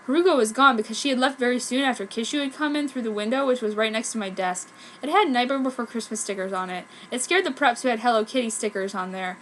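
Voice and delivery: ordinary delivery, nothing marked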